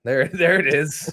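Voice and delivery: laughing, loud and slow. Real, not acted. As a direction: crack up while saying it. Laughing at the trailer voice.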